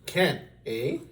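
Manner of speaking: high scornful challenge; scoffing. Acted. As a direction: scoff at the idea. King challenges the page.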